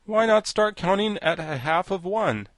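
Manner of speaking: lightly voiced blunt interjection; abrupt. A narrator's reading. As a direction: break in with a sudden question. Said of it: "asked the Sawhorse abruptly".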